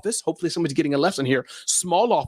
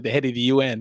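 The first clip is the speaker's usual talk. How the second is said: ordinary talk, nothing marked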